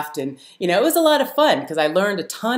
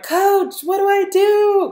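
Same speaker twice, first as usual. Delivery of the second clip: high, loud call for help; yelling for help; 5 dB louder, slower than usual. Retold or imitated. Stranded kayaker yelling down to coach, panicked. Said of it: "I yell down at the".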